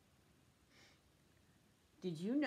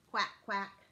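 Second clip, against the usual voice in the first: duck quack; 3 dB louder than usual. Pretend. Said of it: "Hear me quack".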